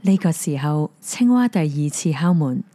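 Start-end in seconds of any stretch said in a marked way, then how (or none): none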